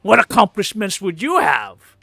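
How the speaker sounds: proud mercenary captain, puffed up; boastful hobgoblin captain. Acted. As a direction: brag about the Red Hand.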